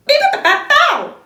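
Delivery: mock like cheeky monkeys; monkey chatter.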